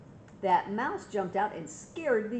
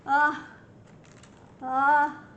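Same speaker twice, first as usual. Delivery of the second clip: loud high ah before a sneeze; building sneeze; 6 semitones higher, 8 dB louder than usual. Acted. Elephant about to sneeze, loud rising ah. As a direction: wind up to a big sneeze.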